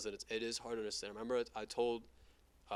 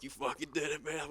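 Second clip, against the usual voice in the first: higher cheer of congratulation; excited, raised-pitch congratulation; imitated proud dad; 6 semitones higher, 3 dB louder than usual. Retelling proud dad's cheer.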